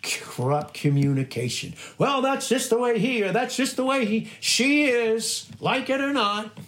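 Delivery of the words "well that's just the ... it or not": high-pitched dismissive imitation; mock excuse-making. Preacher mimics people excusing someone's corrupt talk, mock dismissiveness.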